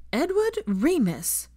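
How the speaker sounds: slow, loud reproachful full-name call; a parent scolding by full name. A narrator's reading. Harry reprimanding Teddy's guilt trip, slow scolding call.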